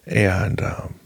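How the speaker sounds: ordinary talk, nothing marked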